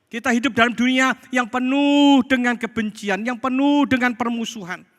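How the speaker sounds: high, loud proclamation; full-force preaching. Real, not acted. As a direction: proclaim it to the whole congregation.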